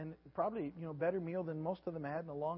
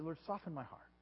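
soft, humble sample prayer; quiet prayer; 3 semitones lower, 7 dB quieter than usual. Acted. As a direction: pray gently, as if alone with God. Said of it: "we have to ask God continually".

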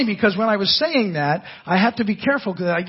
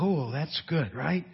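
mild approval; 5 semitones lower, 7 dB quieter, slower than usual. Retold or imitated. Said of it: "you're like".

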